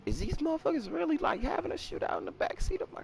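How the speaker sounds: half-whispered high incredulity; disbelief. Retold or imitated. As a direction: ask it like you can't believe your eyes. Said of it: "I'm just sitting there like".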